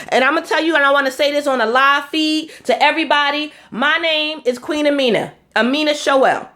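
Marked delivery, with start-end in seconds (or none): none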